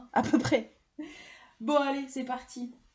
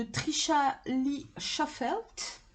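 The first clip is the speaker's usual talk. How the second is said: put-on drawn-out English accent, pitched higher; 5 semitones higher, much slower than usual. French vlogger showing off the deck creator's name, mock-proud English accent. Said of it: "avec mon superbe accent anglais".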